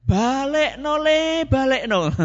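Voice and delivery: a fed-up mum ordering him home, drawn out; exasperated mother. Retold or imitated. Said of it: "ibunya saudara saya ini mengatakan".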